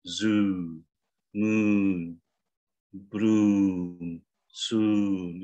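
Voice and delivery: ZOO, MOON, BROOM, SOON with drawn-out oo; long pouted vowel. Shown as a demonstration. Coach demonstrating long words to the class, pouted vowel. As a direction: pout the lips and hold each oo long. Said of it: "biasanya bacanya panjang, monyong mulutnya".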